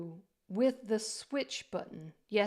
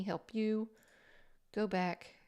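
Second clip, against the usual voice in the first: ordinary talk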